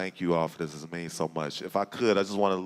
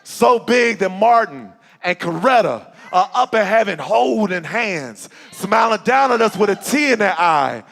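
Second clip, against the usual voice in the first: spoken word poem; 13 semitones higher, 12 dB louder than usual. Acted. Closing lines of the poem.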